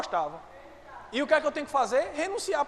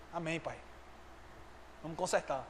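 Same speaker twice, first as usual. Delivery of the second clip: humble reply; 7 semitones lower, 10 dB quieter than usual. Acted. Believer answers God humbly.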